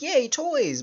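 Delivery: playful high-pitched kid impression; mock kid cheer. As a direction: chirp it like a kid thrilled by toys. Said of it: "kids will always, you know, like".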